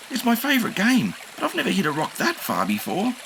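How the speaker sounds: put-on high eager kid; young dinosaur. A narrator's reading. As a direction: say it keenly. Enki voice.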